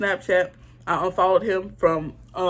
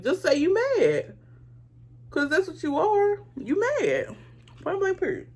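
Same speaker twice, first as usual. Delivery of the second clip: a sassy mic drop, pitched up; sassy verdict; 8 semitones higher than usual. Real, not acted. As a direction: call them out, high and final. Sassy final verdict on hater.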